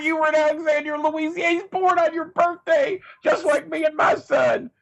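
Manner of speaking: overjoyed, nearly shrieking; host cheering the staged bond, hammed-up excitement; gushing host. Host gushing over the reunion.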